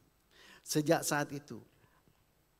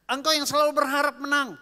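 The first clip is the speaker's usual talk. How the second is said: much louder and higher, crying out; preacher's shout; 13 semitones higher, 14 dB louder than usual. Real, not acted. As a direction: shout it over the congregation. Preacher cries out to congregation. (seruan keras pengkhotbah)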